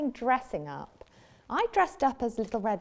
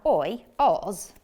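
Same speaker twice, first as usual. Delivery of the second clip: ordinary delivery, nothing marked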